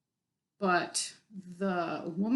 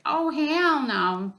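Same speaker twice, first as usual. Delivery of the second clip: loud, slow and higher: oh hell no; angry drawn-out outburst; 7 semitones higher, 6 dB louder, much slower than usual. Retold or imitated. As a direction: stretch out "hell" in a fed-up rant. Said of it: "have a bit of a rant".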